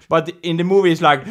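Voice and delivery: normal talking voice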